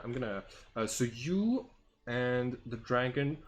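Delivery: ordinary talk, nothing marked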